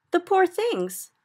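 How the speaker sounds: high, slow anguished cry of pity. A narrator's reading. Sophie cries out in pity as dreams are whisked, high. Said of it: "Sophie cried".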